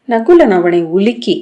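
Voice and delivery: ordinary delivery, nothing marked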